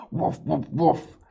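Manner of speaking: playful dog barks; barking. A narrator's reading. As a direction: bark like the dogs. Narrator barking like dogs.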